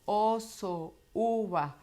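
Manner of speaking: "Oso, uva" with fully rounded lips; "Oso, uva" like a schoolchild's clear reading; rounded, forward articulation. Shown as a demonstration. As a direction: pronounce "Oso, uva" slowly and forward, every vowel open. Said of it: "suenan muy lindo adelante".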